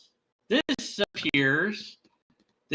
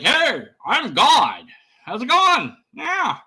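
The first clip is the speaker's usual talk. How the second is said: lightly acted, loud, higher-pitched greeting; casual self-declared God; 7 semitones higher, 5 dB louder than usual. Volcano creature introducing itself as God, lightly hammed-up. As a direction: play a chummy creature, hinting at a character. Acting out creature claiming godhood.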